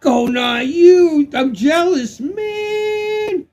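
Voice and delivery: high, loud envious shout; wailing shout, last word stretched high; jealous outcry. Real, not acted. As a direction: cry out in playful envy. Cries out in envy at Goldeneye.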